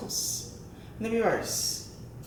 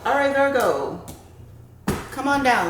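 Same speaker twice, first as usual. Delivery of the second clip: game show host call; 6 semitones higher, 6 dB louder than usual. Real, not acted. Calling the sign like a host.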